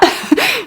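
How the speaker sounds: short high-pitched giggle; a quick embarrassed giggle. Real, not acted. Laughs after plea.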